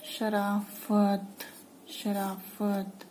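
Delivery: शराफत slowly with f, sharafat; slow nukta f. Shown as a demonstration. Teacher drills शराफत slowly with nukta f. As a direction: draw out शराफत with soft f. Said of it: "कर्ज की sound ऐसे आएगी".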